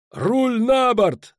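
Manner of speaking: high, loud, drawn-out command; stretched-out skipper's shout; roared order. A narrator's reading. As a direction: yell the helm order with full force. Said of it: "зарычал Пудлорок".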